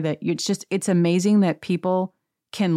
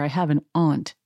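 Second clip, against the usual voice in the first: normal talking voice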